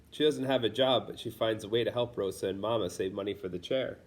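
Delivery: plain reading voice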